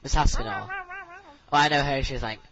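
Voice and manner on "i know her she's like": usual speaking voice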